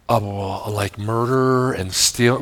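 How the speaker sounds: put-on a nervous boy answering his father's question; timid child answer. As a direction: answer unsure, afraid of being busted.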